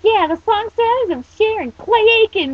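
high, loud mocking voice; gleeful jeer. Creature ribs the teen, high gleeful jeer. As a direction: crow it out gleefully. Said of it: "Mokono laughed".

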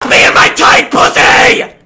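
slow strained yell; drawn-out outburst of frustration; frustrated shout. Real, not acted. Frustrated outburst after dying.

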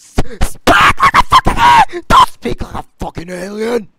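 very high, stumbling scream; shrieking yell. Acted. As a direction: screech it out.

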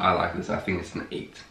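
normal talking voice

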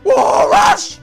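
high shouted cry; excited shout. Real, not acted. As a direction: yell with delight. Excited game reaction. (begeisterter Schrei)

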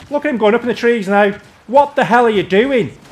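high, loud, incredulous scolding; outraged. Real, not acted. Gamer watching teammate go up in the trees, outraged yell. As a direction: demand an answer in exasperated disbelief.

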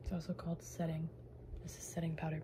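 whispered product name; whispering. Real, not acted.

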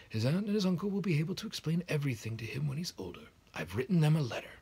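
kindly headmaster, pitched high and a bit loud. A narrator's reading. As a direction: chide her fondly, slowing on nonsense.

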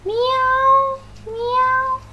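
high mewing meow like a cat. Shown as a demonstration. Teacher imitating a cat for learners. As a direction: mew it like a kitten. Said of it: "is right to say meow".

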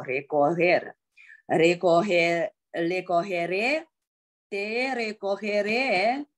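recoger and recogeré drawn out slowly; slow model phrase. Shown as a demonstration. Teacher slowly drilling recogeré for the class. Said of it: "我去接别人的时候".

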